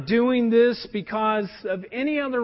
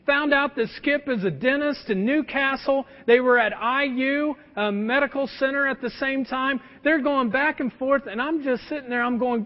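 normal talking voice

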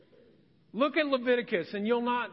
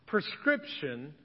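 ordinary delivery

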